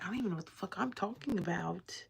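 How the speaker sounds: whispered thought. Retold or imitated. Retold inner thought, whispered.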